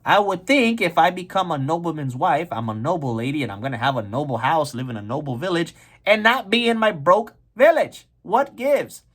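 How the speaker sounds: complain, ending high on exasperated protest; puzzled, annoyed wife. Acted. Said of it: "Maria was like".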